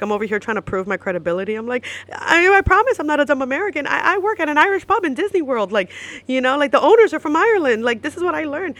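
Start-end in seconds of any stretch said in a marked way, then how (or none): 2.1-8.7 loud, high-pitched insistent protest; defensive pleading. Retold or imitated. Proving her credibility to the Irishman, defensive.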